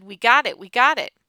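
jubilant, punched exclamation; excited shout. A narrator's reading. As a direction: cry it out in celebration. Character exclaims over first smoke.

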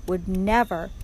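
ordinary delivery, nothing marked

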